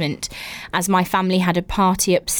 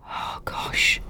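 breathy whispered "gosh", voice dropped away; 8 dB quieter than usual. Real, not acted. Reacting to the foot story in a horrified whisper. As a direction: hush it in horror. Hearing foot run over thrice.

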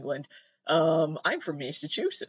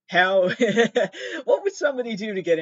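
laughing; 6 semitones higher, 5 dB louder than usual. Real, not acted. Laughing at the Harvard cheating news.

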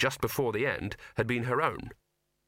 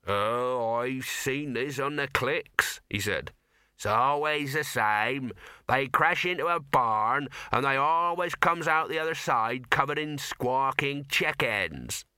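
drawling country farmer, sure of himself; rustic farmer; slower than usual. Acted.

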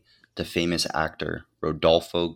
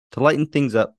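excited; 9 semitones higher, 8 dB louder than usual. Acted. Podcast intro, voice jumps up.

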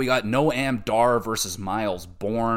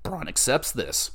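usual speaking voice

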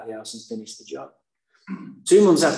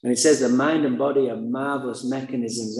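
normal talking voice

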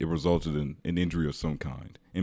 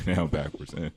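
usual speaking voice

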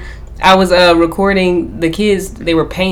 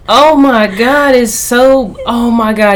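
higher, drawn-out fake awe; mock amazed praise; 7 semitones higher, slower than usual. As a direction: gush over a child's drawing, hammed up. Mock praise of child's picture.

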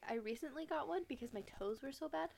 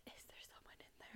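hushed whisper toward the closet; scared whisper sitting by the closet; 8 dB quieter, much faster than usual. Retold or imitated. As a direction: whisper it so the ghost can't hear. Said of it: "and I'm like".

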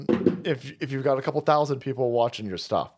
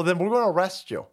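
ordinary delivery

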